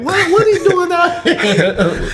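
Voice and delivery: high-pitched, drawn-out astonished question; mock amazement. Schoolmates reacting to his tap dancing, mock amazement. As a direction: imitate stunned onlookers, slow and high.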